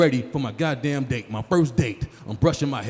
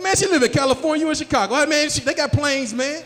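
incredulous; 10 semitones higher, 6 dB louder than usual. Retold or imitated.

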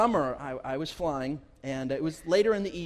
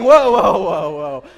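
alarmed pilot whoa; 3 semitones higher, 16 dB louder, slower than usual. Retold or imitated. Pastor re-imitating the pilot's alarmed whoa, louder. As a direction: blurt it out like a pilot caught off guard. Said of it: "when you hear your pilot saying".

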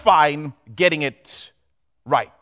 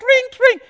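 high sing-song imitation of a ringing phone; mock phone ring; 4 dB louder than usual. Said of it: "imitated phone".

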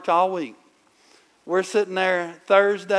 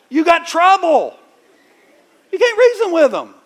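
builds higher and louder to a shout; rising preacher's cry; 6 semitones higher, 6 dB louder than usual. Real, not acted. Preacher to parents about teenagers, building to a loud peak. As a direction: preach it to the back row, climbing.

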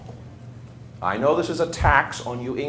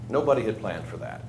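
softer and lower, let it sink in; a hushed rueful echo of the line; quiet echo; 5 semitones lower, 9 dB quieter than usual. Real, not acted. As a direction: repeat it under your breath to yourself. Repeats the line for effect.

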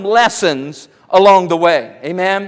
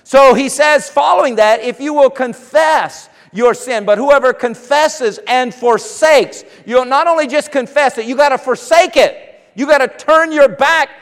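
higher, louder, slowing preacher's exhortation; preaching; 5 semitones higher, 4 dB louder than usual. Acted. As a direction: press it home to the congregation. Preaching at rising force.

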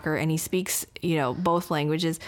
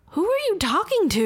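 surprised; 11 semitones higher than usual. Retold or imitated. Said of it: "And I was like".

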